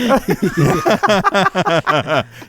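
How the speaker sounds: high burst of laughter. Real, not acted. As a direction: laugh heartily at your guest. Laughs at the guest's worry.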